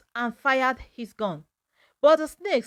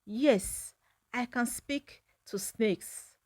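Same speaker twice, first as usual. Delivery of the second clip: softer, slow, hushed admission; whispered confession; 6 dB quieter, slower than usual. A narrator's reading. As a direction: let it out slowly, barely audible. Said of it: "took a deep breath and whispered".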